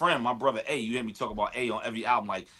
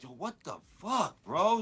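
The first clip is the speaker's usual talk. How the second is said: higher, stunned friend reacting to the album; amazed exclaim; 5 semitones higher than usual. Best friend hearing the new album, amazed exclaim. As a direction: blurt it out like you can't believe your ears. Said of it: "my bro A was like".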